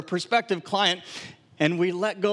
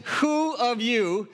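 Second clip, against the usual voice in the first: sarcastic; 3 semitones higher, much slower than usual. Retold or imitated. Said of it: "funny and quite sarcastic way".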